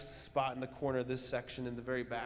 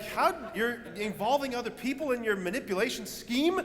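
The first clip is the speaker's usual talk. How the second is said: higher, louder, mock outraged protest; ticked off; 9 semitones higher, 5 dB louder than usual. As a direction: replay your irritation at a friend, half-joking. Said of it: "And I was ticked".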